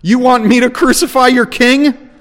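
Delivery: high, loud, scornful challenge; scornful question. A narrator's reading. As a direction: throw the question back at them with contempt. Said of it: "again with scorn".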